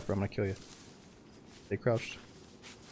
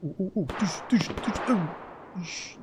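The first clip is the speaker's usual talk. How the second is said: gunshot noises; 8 semitones higher, much slower than usual. Retold or imitated. Imitating gunshots watching fight.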